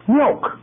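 Milk. lifted high and stressed; preacher pointing the word Milk. up high; emphatic milk. Real, not acted. Preacher stresses simplest food.

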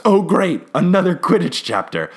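loud, high mock-exasperated outburst; all caps. Host reading out their first note, loud all-caps exclamation. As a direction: read it out as if typed in capitals. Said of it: "all caps".

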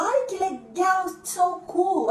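high-pitched, drawn-out delight; admiring gush. Acted. Friend admiring socks in a shop, high excited gush. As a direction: gush over them in a high voice. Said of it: "E aí você fala".

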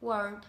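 "world" ending in a gently released D; light final D. Shown as a demonstration. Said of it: "phát hơn một chút thôi".

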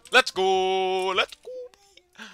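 high cry climbing to a squeal; triumphant cheer. Real, not acted. Gamer celebrating the first kill, triumphant cheer. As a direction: celebrate loudly, voice shooting up.

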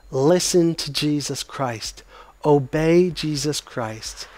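each word weighed slowly; solemn call to obey; slow emphatic appeal. Acted. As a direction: press it home, slowly. Exhortation climax.

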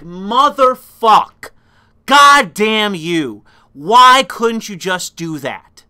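loud, slow, drawn-out furious cursing; angry cursing. Retold or imitated. Cursing the filmmakers for a missed ending, slow loud anger. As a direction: drag each curse out in anger. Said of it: "And I was like".

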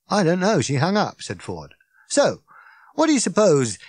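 a wound-up alien rambling on; Ford, tipsy and indignant; Ford, excited. Acted. Ford questioning Arthur.

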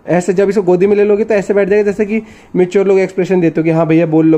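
normal talking voice

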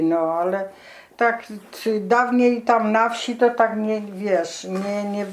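usual speaking voice

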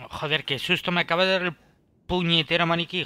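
jumps and yells, pitch high; loud shocked outburst; startled shout. Real, not acted. Startled by a mannequin.

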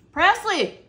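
high, loud food rave; delighted raving. Real, not acted. Raving after the first bite.

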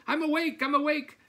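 very high, slightly loud hen voice; two quick high-pitched protests; startled hen. A narrator's reading. Second hen woken by the farmer, high and flustered.

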